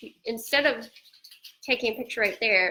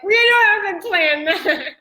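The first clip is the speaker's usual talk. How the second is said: laughing; 6 semitones higher, 9 dB louder than usual. Retold or imitated. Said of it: "I'm like".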